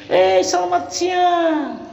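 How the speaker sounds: high, slow surprised greeting; surprised host. Acted. Host surprised at a visiting friend, slow and high. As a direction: draw out a pleased welcome. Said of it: "didatangi teman-teman".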